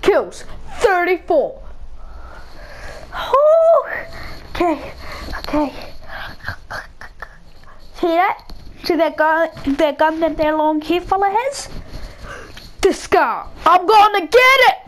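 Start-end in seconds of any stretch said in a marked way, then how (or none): 3.1-3.8 high relieved whew. Real, not acted. Relief after tense fight. 13.6-14.7 excited shout. Real, not acted. Spots the gun he wants.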